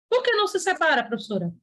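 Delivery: ordinary talk, nothing marked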